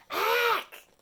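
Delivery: high, loud choking yelp; terrified ack. Acted. Turtle choking on a bag, a terrified high yelp. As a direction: yelp in panic as it sticks in the throat. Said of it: "responded with a terrified".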